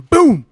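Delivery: loud, high "boom"; imitated door bang. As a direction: burst out a door-slam noise. Mimics the door bursting open.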